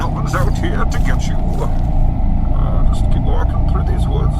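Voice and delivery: fast whisper to himself; nervous whisper. Acted. Bane whispering to calm himself in the dark woods. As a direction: hush yourself, keep walking. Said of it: "Calm down, Bane".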